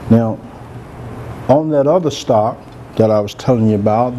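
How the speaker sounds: ordinary talk, nothing marked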